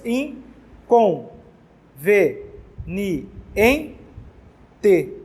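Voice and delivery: "In, com, ve, ni, en, te" split with pauses; teacher separating syllables for the class. Shown as a demonstration. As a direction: count out each syllable separately. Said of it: "contam-se seis sílabas".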